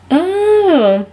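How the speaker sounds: high, long rising-and-falling "oh"; mock polite alarm, stretched out; dismayed realization. Date learns she studies dietetics, stretched dismayed "oh". Date reacts to her major.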